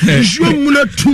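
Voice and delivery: slow shouted line, pitched high; preacher's shout. Real, not acted. Preacher shouting slowly to his congregation. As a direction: call it out slowly to the whole room.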